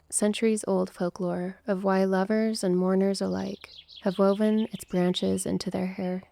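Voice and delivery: ordinary talk, nothing marked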